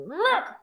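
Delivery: high, mock angry growl; child venting frustration with a growl; frustrated growl. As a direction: let out a kid's frustrated grr. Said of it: "And they go".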